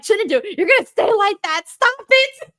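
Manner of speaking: mock mom telling her son to stop crossing his eyes; scolding mom voice. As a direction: scold him like a mother. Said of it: "I'm like".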